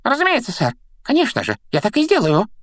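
very high squeaky, servile poltergeist; frightened Peeves. A narrator's reading. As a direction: squeak out anxious obedience. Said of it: "испуганно пробормотал Пивз".

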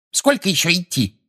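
high-pitched, mildly angry demand; cross. A narrator's reading. Umbridge from behind demands how much further, cross. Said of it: "сердитый голос Амбридж".

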